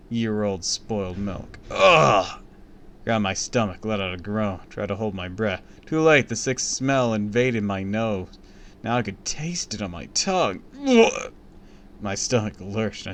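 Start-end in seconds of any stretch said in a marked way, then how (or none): 1.7-2.4 short loud retching ugh; disgusted groan. A narrator's reading. Boy hit by a rotten smell, disgusted ugh. 10.9-11.3 high, loud gag; gagging ugh. A narrator's reading. Boy gagging on the rotten taste, loud ugh. Tasting the smell.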